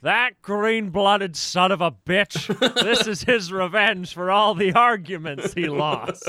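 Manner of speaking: McCoy impression. Quoting McCoy's line to a fellow fan, loud and indignant. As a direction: spit it out like an outraged ship's doctor. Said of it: "favorite McCoy line".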